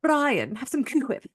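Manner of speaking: lightly voiced Stewie Griffin; a hint of Stewie's voice; Stewie impression. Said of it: "He almost sounds like Stewie".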